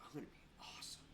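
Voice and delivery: hushed whisper of self-belief; whispered self-talk. Retold or imitated. Telling himself he will be awesome, whispered. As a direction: whisper it to yourself like a secret boast.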